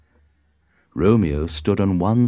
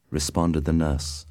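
plain reading voice

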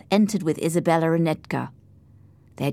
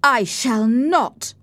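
slow, higher-pitched defiant refusal; angry; 6 semitones higher, 4 dB louder, much slower than usual. A narrator's reading. Heathcliff refuses Hindley's order to shake hands, angry. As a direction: refuse stubbornly, drawing the words out. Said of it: "replied Heathcliff angrily".

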